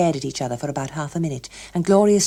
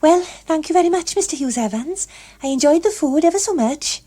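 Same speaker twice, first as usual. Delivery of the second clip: put-on young Gloria, polite and bright; 11 semitones higher, 4 dB louder than usual. A narrator's reading. Gloria's reply in the street.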